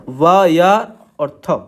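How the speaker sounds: व, या और थ said very slowly part by part; very slow spelling. Shown as a demonstration. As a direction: stretch out व, या और थ one by one. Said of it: "इसे आप पढ़ेंगे व्यर्थ". (बहुत धीरे-धीरे अक्षर गिनाना)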